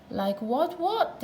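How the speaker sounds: high, rising innocent question; puzzled twins. A narrator's reading. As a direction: ask innocently as if nothing's odd.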